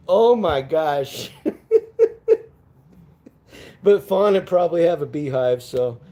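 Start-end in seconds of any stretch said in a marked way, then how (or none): 1.4-2.4 squealing burst of laughter; shrill laugh fit; high shrieking laugh. Real, not acted. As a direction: let out a wild, high cackle. Explodes laughing.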